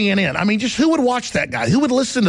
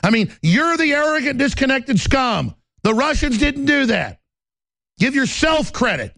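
angry rant; 6 semitones higher than usual. Real, not acted. Ranting at media elites.

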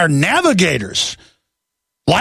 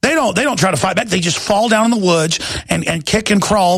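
ordinary talk

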